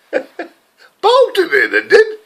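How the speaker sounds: high, laughing burst of glee; laughing delight. Real, not acted. Laughing as he recalls the bloody horse winning. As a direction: laugh it out in disbelief.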